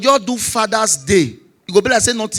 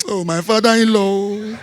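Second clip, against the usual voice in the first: mock crying; 4 dB quieter than usual. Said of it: "my wife come join me".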